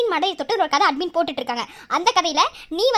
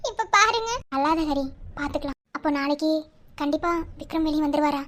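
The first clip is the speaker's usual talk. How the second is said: ordinary talk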